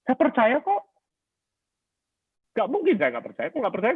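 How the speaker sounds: very high, protesting self-justification; defensive insistence. Retold or imitated. Husband insisting to his wife he trusts her, high defensive protest. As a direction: mimic yourself insisting you're innocent, pitch shot right up. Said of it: "saya mempertahankan diri bahwa saya benar".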